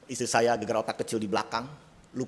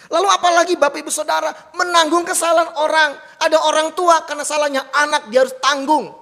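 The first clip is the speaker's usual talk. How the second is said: higher, louder impassioned preaching; full-force preaching; 14 semitones higher, 7 dB louder than usual. Acted. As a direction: drive the point home to the congregation. (khotbah berapi-api, lebih tinggi dan keras)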